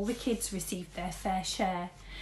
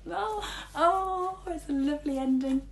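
delighted; 8 semitones higher, 4 dB louder than usual. Real, not acted. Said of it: "reader delights at ending".

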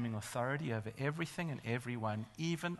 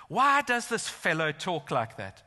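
loud, slow, acted indignation; acting out an offended accuser; indignant challenge; 3 semitones higher, 7 dB louder, slower than usual. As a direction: demand it loudly, drawing out the question. Acts out indignant teachers of law.

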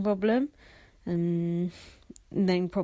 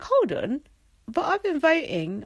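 high-pitched objection; retold outcry, pitched well up; mock protest; 7 semitones higher than usual. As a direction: pipe up high in indignant protest. Acting out own protest at twist.

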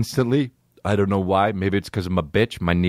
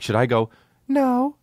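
a high, put-on woman's "No"; falsetto woman's voice. Wondering whether to fake a woman's voice, high falsetto. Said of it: "make my voice like a woman".

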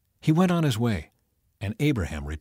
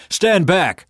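loud, high angry shout; 8 semitones higher, 6 dB louder than usual. A narrator's reading. The mob yelling at Lot to get out of the way, loud and angry. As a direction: bark it at him like a threat. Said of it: "they shouted".

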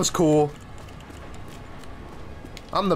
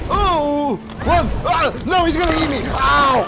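high, urgent cries; panicked shouting; 4 semitones higher than usual. Real, not acted. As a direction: yell in alarm as if chased. Panics as monster attacks.

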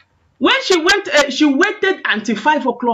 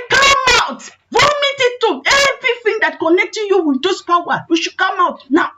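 exorcism command; 9 semitones higher than usual. Retold or imitated. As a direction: command the spirit out as the mother. Mother ordering spirit out of child.

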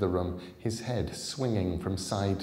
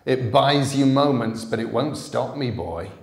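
loud, higher shout through a door; raised voice; 5 semitones higher, 12 dB louder than usual. Acted. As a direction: yell it through the locked door. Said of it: "The man Jack raised his voice".

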